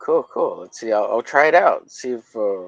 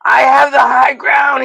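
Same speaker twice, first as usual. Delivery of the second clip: hammed-up movie duel; 10 semitones higher than usual. Streamer and Peter in a mock sci-fi duel, high shouting. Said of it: "And I'm like".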